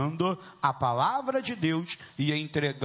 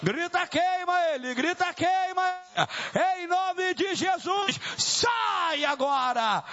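very high, sustained rallying shouts; full-force exorcism preaching. Real, not acted. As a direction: whip the congregation up with high, ringing commands. Leads congregation in exorcism shouts.

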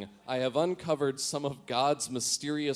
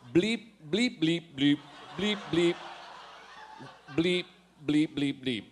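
robot bleeps; 2 semitones higher than usual. Retold or imitated. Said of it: "I am a robot now".

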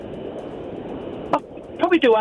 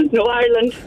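put-on a touch of an Irish accent. Caller as [name] correcting the hosts, Irish accent. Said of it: "do you think she's putting on an accent".

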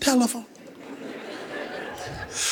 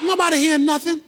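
raised preacher's jab; preaching punch; 5 semitones higher, much faster than usual. Acted. Preacher telling the congregation nobody heard, raised preaching jab. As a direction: hit it like a sermon punchline.

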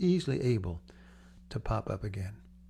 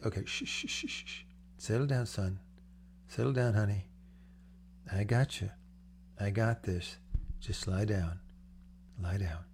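put-on quiet yourself like a parent hushing a child; self-soothing hush; 2 semitones lower, 4 dB quieter than usual. Preacher calming his own anxious heart, soft soothing hush.

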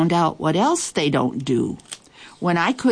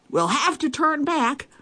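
much higher call; 10 semitones higher than usual. A narrator's reading. Another pirate calls out during the storm. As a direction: call it across the deck. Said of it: "called another".

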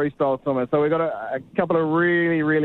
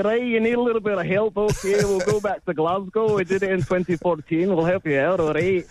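higher-pitched mock Scottish accent; 4 semitones higher than usual. Reporter imitating Scots offering to host the Games, Scottish accent. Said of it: "as good as your Scottish one".

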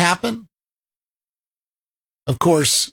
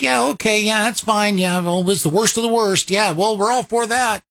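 higher-pitched mock-agreeable imitation; mock agreement; 5 semitones higher than usual. Host mimics elite feigning support, mock agreeable. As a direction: parrot their insincere, hurried assent. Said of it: "to make it look like".

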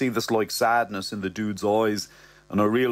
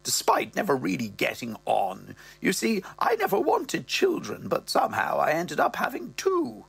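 higher mother voice; mother's voice; 8 semitones higher than usual. Acted. Mother confiding to Ross, higher voice. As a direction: lift into the old dear. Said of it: "the old dear is there".

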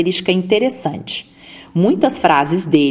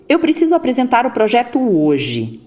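starts higher, then falls away to "hoje"; descending statement; 4 semitones higher than usual. Shown as a demonstration. Speech coach models an affirmation for listeners, falling intonation on "hoje".

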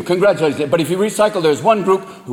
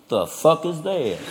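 muttered disbelief; 4 semitones lower, 6 dB quieter, slower than usual. Acted. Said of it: "you're like".

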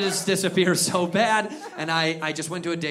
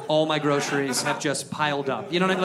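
ordinary talk

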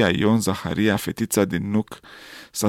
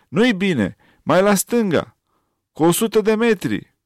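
raised, higher shouted orders; soldier shouting; 9 semitones higher than usual. A narrator's reading. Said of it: "ostașul striga". (strigăt de soldat)

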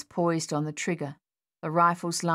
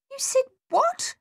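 much higher, stunned question; stunned disbelief; 3 dB louder than usual. A narrator's reading. Captain stunned by the soldier's words, incredulous. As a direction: cry it out in disbelief.

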